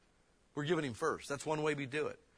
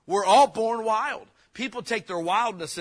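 preaching loud; 7 semitones higher, 10 dB louder than usual. Real, not acted. Preacher building to a climax.

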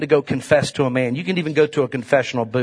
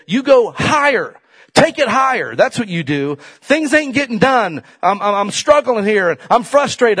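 higher and louder, building to a shout; preaching at full force; 7 semitones higher, 4 dB louder than usual. Acted. Preacher urging the church to take it higher, shouting. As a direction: drive it home to the congregation, climbing.

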